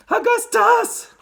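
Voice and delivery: very high, drawn-out cry; loud, piping woman's call; Missus Gloop. A narrator's reading. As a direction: shriek it like a frantic mother. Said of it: "cried Missus Gloop".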